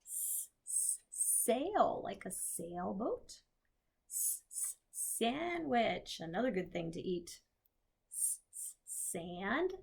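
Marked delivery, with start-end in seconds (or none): 0.1-1.0 hissed S-, s-, unvoiced; isolated S sound. Shown as a demonstration. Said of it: "One of my favorite S words". 4.1-4.8 hissed S-, s-, unvoiced; isolated S sound. Shown as a demonstration. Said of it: "One of my favorite S words". 8.1-8.8 hissed S-, s-, unvoiced; isolated S sound. Shown as a demonstration. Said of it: "One of my favorite S words".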